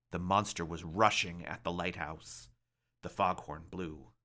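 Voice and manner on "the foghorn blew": plain reading voice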